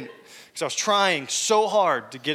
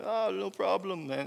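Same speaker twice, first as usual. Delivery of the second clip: put-on botched German accent; 8 dB quieter than usual. Pastor retelling the drunk German on the phone, in a botched German accent. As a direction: try a German accent and let it slide Jamaican. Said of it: "that's not Jamaican, but German for some reason".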